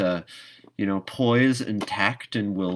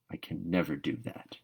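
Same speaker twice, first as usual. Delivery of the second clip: determined and dark; 4 semitones lower, 9 dB quieter than usual. Acted. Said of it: "determinedly and darkly".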